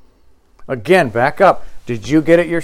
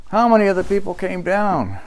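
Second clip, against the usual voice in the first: ordinary talk, nothing marked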